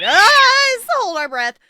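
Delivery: sudden high loud gasp; startled yelp. Real, not acted. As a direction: jump at a scare. Startled, holding breath in hiding.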